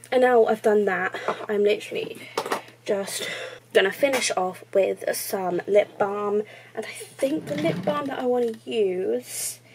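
usual speaking voice

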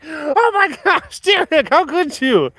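high, slow, dramatic gasp of betrayal; mock outrage. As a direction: ham up the crowd's shocked disbelief. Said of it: "everyone was like".

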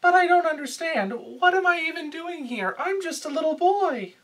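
put-on high, whiny young boy voice. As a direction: play a timid little anime boy. Parody Shinji whining to father.

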